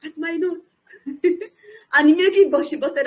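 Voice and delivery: bursting into laughter mid-sentence; laughing. Real, not acted. Recalling the lecture scene, laughing out loud. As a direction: laugh through the words.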